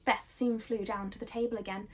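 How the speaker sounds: usual speaking voice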